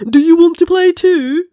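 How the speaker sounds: friendly tiger. Acted. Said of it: "Tiger smiles and asks".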